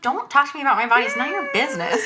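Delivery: mock sassy retort. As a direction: snap back fast. Fast retort, high put-on end.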